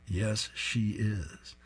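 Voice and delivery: deadpan reply. Acted. Coach's slow deadpan reply.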